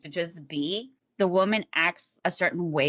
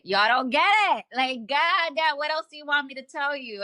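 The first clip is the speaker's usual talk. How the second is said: raised voice, fed up with explaining; exasperated outburst; 7 semitones higher than usual. Retold or imitated. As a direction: burst out as if nobody is getting it. Repeats it, exasperated at listeners.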